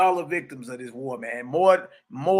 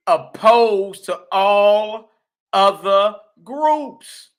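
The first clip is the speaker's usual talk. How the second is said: very slow, higher and louder, every word weighed; slow emphatic reading; 4 semitones higher, 7 dB louder, much slower than usual. Shown as a demonstration. Teacher reads the book's phrase very slowly and loudly. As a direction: stretch it out like a verdict.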